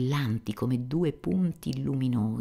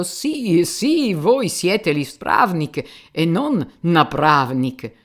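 loud, higher shouting, drawn out slowly; 7 semitones higher, 11 dB louder, much slower than usual. Retold or imitated. Fyodor shouts after the departing police chief. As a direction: call it after him at full voice.

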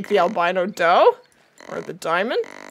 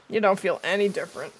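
normal talking voice

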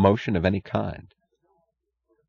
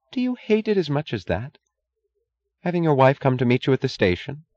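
higher-pitched wife challenging her husband; reproachful wife; 7 semitones higher than usual. A narrator's reading. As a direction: press him with a wounded question.

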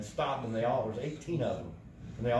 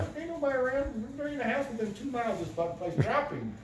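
excited urging; 12 semitones higher than usual. Retold or imitated.

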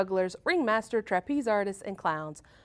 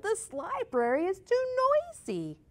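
high exasperated slow complaint; 11 semitones higher than usual. A narrator's reading. Stevie still yelling.

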